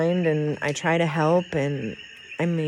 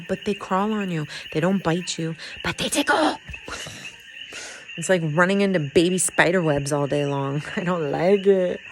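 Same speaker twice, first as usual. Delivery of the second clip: ordinary delivery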